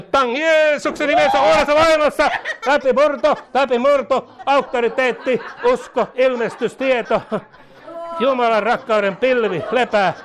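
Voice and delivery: high, loud, punched-out declarations; full-force charismatic prayer. Real, not acted. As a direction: cry out each word as a breakthrough command.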